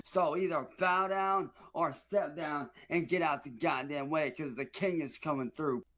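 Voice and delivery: boastful wrestling promo; swaggering self-crowned king promo; hype promo. Acted. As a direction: puff up like a wrestler cutting a promo.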